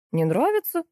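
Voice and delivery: puzzled question leaping high; sincere surprise. A narrator's reading. Butcher questions the customer's refusal, sincerely surprised. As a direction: ask in honest astonishment. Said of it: "искренне удивился мясник".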